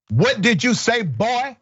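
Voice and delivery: a hint of a Southern good-old-boy judge. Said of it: "I'm using my best redneck accent".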